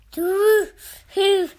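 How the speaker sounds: loud hooting owl calls; owl impression. Child showing the listeners his owl, loud hoots. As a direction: hoot out like an owl. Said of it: "and this is my owl".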